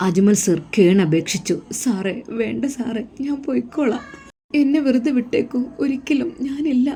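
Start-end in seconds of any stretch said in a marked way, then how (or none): 1.8-6.9 put-on higher, soft pleading young woman; Muhsina's voice. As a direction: plead softly as the frightened girl.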